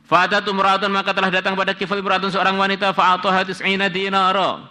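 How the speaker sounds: loud, high-pitched kitab recitation; pembacaan kitab. Acted. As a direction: read out the Arabic and translation high, loud and fast-flowing. Membaca kitab dan menerjemahkan. (membaca kitab dengan suara tinggi dan lantang)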